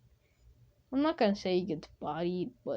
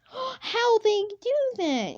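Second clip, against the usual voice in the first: high, slow, amazed squeak; hammed-up amazed girl voice; surprised; 13 semitones higher, slower than usual. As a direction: gasp it out like a wide-eyed girl. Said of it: "she's gonna be like".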